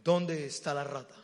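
low, swaggering tough-guy voice; cocky rat hunter. Retold or imitated. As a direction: drop the voice and act unbothered. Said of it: "les voy a decir con qué actitud llegó".

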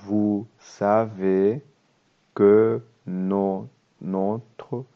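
slow, careful French reading for learners. Shown as a demonstration. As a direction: read each word slowly so students can follow. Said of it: "leer el diálogo muy despacio". (lectura muy lenta y clara)

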